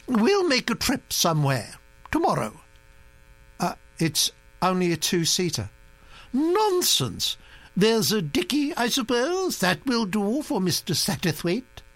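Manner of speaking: high, commanding grand-lady voice; a bossy old aristocrat laying down plans; imperious old Duchess. A narrator's reading.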